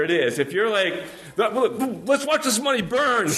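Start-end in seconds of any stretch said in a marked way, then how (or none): 1.4-3.3 higher, mocking voice of a producer happy to waste money; mock careless producer. Mocking a producer who lets budget burn, high smug voice. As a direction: play a smug producer gleefully burning cash.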